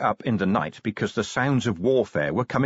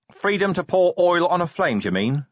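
raised, louder challenge; demanding; 10 semitones higher, 4 dB louder than usual. A narrator's reading. Commander challenging the photographer's excuse, demanding. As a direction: throw it back as a sharp demand. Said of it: "Vimes demanded".

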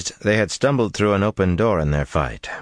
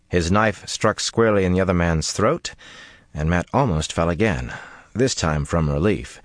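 ordinary delivery, nothing marked

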